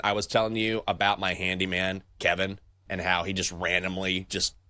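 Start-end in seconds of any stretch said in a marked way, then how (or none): none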